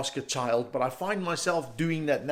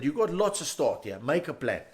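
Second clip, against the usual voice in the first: normal talking voice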